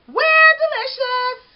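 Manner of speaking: high, loud, slow chorus shout; shouting back. A narrator's reading. The donuts answer the baker from the shelves, high loud shout. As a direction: shout it back cheerfully as a crowd. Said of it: "the donuts shouted back".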